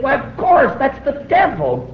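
the penny drops all at once; sudden realization. Retold or imitated. Preacher recalling his realization, sudden surprise.

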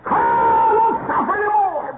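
high, slow, loud cry through tears; crying shout. Real, not acted. Preacher cries out over his dead friend Carl. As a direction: wail it in grief.

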